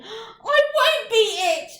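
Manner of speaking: high, loud crying wail, slow; loud, high tearful cry rising on All; weeping protest. Acted. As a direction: sob it out, lying fallen in the hose. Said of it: "lay there weeping".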